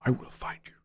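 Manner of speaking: whispered Liam Neeson threat. Retold or imitated. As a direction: breathe it like a calm, deadly threat. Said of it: "I'd love to do Liam".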